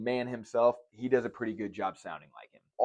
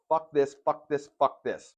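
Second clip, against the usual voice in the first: Carlin rant; 5 semitones higher, 4 dB louder, slower than usual. Retold or imitated. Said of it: "It's just".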